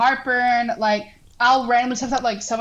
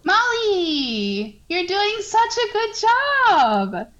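sweet whisper; 8 semitones higher, much slower than usual. Shown as a demonstration. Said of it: "Oh my God, that was so sweet".

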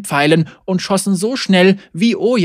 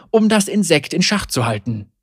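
usual speaking voice

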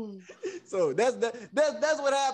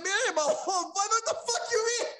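high, laughing mock shout; retold angry reply; 9 semitones higher than usual. Speaker snapping back at Blake, high laughing mock shout. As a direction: push back hard. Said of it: "I'm like".

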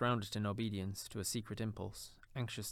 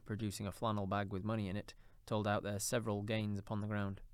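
ordinary delivery

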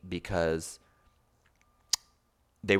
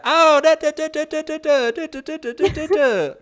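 much higher and louder mock Southern chatter; loud Southern woman; 10 dB louder than usual. Mocking the loud Southern woman at the cafe, high and loud. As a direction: belt out a loud Southern lady's babble in imitation. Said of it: "she's loud and she's Southern and she's like".

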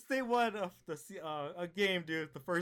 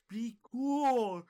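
higher, louder goofy Patrick voice; Patrick impression; 6 semitones higher, 7 dB louder than usual. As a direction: play it dumb and proud like Patrick. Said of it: "he's like".

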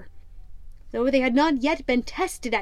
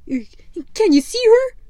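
high, eager sailor voice asking; a much higher, louder lookout's question; squat sailor; 10 semitones higher, 8 dB louder, slower than usual. Acted. Squat sailor asks the tall man, high and eager.

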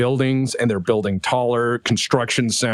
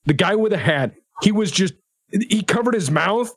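angry ranting officer; 8 semitones higher than usual. Acted. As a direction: rant at them, outraged.

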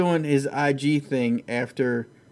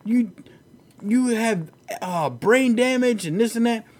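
much higher, hammed-up taunting voice; mock Colby trash talk; 9 semitones higher than usual. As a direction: taunt him like a cocky fighter. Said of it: "Colby Covington's like".

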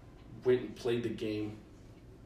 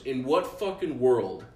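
louder, slow, incredulous retold outburst; exasperated dad; 5 dB louder than usual. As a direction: imitate an angry dad, drawing out each word. Retelling dad's angry outburst.

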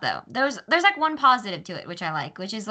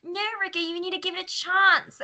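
pitched-up mimicked accent; mocking TV-show accent; 11 semitones higher than usual. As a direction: plead in a hammed-up TV accent. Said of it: "making fun of H two O".

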